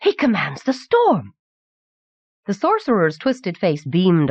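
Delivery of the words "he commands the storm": high, awestruck exclamation; shocked gasp. A narrator's reading. Anagel sees him command the storm, gasping in shock. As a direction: marvel in alarm, pitch raised. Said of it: "gasped Anagel".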